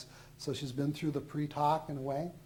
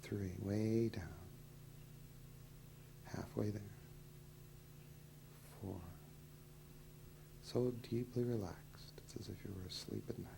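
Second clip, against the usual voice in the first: hypnotist induction; 6 semitones lower, 11 dB quieter than usual. Acted. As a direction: whisper them down into trance. Deepening the hypnotic trance.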